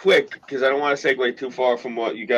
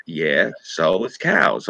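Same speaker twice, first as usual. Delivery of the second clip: unimpressed; 7 semitones lower, much slower than usual. Retold or imitated. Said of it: "They're like".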